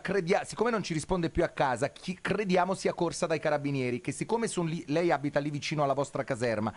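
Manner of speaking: ordinary talk, nothing marked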